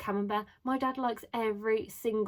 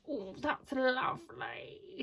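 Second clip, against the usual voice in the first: put-on higher, drawn-out common London accent; mum's London accent; 5 semitones higher, much slower than usual. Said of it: "I do this accent".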